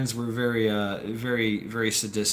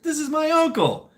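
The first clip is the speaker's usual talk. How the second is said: high-pitched, animated re-enactment; excited reply; 4 dB louder than usual. Retold or imitated. Storyteller replying to his friend at the beach, high and excited. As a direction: answer him in an excited high voice. Acted reply in surprise reunion.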